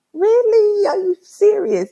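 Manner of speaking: high, loud disbelief; loud, squeaky-high incredulous reaction; retold incredulity. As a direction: question it in high-pitched astonishment. Retelling own incredulous reply to barber.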